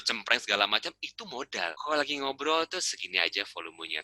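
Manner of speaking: ordinary talk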